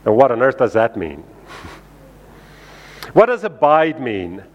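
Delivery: normal talking voice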